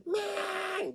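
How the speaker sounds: straining up to a very high held note; as high as possible. Retold or imitated. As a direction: students mimicking a sky-high first tone. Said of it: "getting as high as she could".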